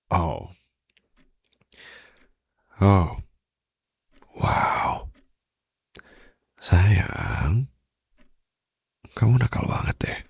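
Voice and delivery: flirty whispered tease. Acted. Flirty whisper to captive listener. (bisikan genit)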